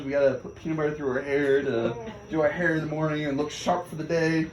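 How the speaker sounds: usual speaking voice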